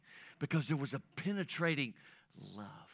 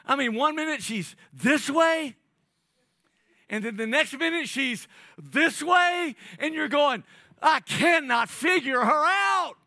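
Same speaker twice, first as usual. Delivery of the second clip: much higher and louder, drawn-out; mock baffled shout; 14 dB louder than usual. Pastor joking to husbands about wives, high comic exasperated shout. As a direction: play a flustered husband at wit's end.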